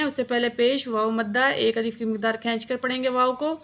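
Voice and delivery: ordinary talk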